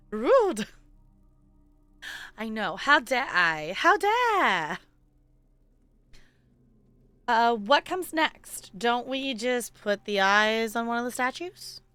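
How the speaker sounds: ordinary talk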